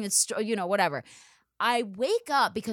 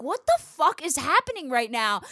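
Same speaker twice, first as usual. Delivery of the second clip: retold incredulous outcry; 6 semitones higher, 3 dB louder than usual. Tied up, reacting to nunchuck display.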